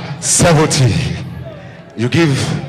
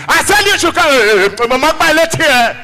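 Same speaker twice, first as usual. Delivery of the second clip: ranting shout; 8 semitones higher than usual. Retold or imitated. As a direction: rant at him without a breath. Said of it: "In the shout".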